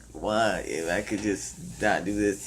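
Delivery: slow, low, dropped-down murmur to himself; weary inner thought. Retold or imitated. Runner at mile twenty doubting himself, slow and hushed. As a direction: let the doubt creep out slowly, almost under breath.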